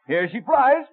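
excited exertion. Acted. Throwing stone, big effort.

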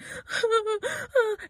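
high-pitched mock sobbing; imitated crying. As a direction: act out breaking into sobs. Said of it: "I just start crying".

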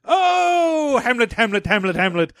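high, hammed-up theatrical lament; dramatic soliloquy. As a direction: declaim like an overacting Shakespearean. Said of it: "you must perform a soliloquy".

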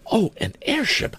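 high-pitched, amazed lady teacher; exclaiming. A narrator's reading. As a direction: exclaim it in high delighted wonder. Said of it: "exclaimed one".

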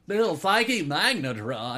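high, loud, slow nerdy voice; nerd voice. Acted. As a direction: savor it like a Poindexter. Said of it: "you have to do the Poindexter voice".

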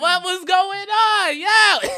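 high, slow bewildered voice; mock confused crowd. Comic retelling to friends, high bewildered mock voice. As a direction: stretch it out, baffled and high.

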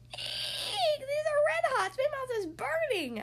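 a shrieking high yelp, mouth on fire; yelled. A narrator's reading. Girl yelling as the red hots burn her mouth. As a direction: squeal in alarm at the spicy candy. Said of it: "I yelled".

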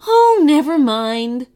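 high, loud, drawn-out baker; breezy dismissal. A narrator's reading. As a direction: brush it off with a big sing-out. Baker shrugs off bad luck.

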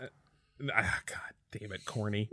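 hushed, unvoiced stumble; whispered slip. Police chief whispering to himself, caught out. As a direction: whisper the slip under breath.

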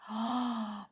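hushed gasp of wonder; soft gasp. A narrator's reading. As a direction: gasp softly. Narrator gasps at the smoke.

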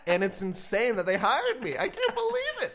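high, loud laugh through the words; laughing disbelief. Real, not acted. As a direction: crack up at yourself. Laughing at own bad answer.

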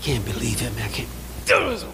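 vexed muttering. Retold or imitated. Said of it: "sitting there vexed".

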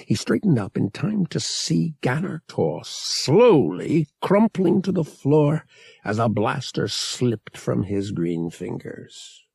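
ordinary delivery

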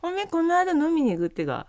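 high-pitched Akita dialect invitation. Pretend. Asking classmate along for drinks, Akita dialect. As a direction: sound out a friend in Akita dialect. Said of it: "ちょっと秋田弁で話してみたい".

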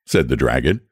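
ordinary delivery

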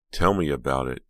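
ordinary delivery, nothing marked

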